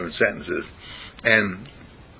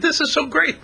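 excited quote. Retold or imitated. Quotes person sharing the video.

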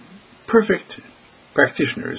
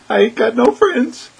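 mock whiny complaint; 11 semitones higher, 4 dB louder than usual. Voicing a self-pitying listener.

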